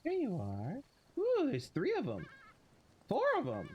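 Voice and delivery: cooing at kittens. Real, not acted.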